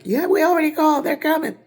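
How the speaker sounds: high, loud, quick reply; high-pitched bystander. Retold or imitated. A bystander telling her help is coming, high and quick. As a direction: answer fast in a squeaky voice.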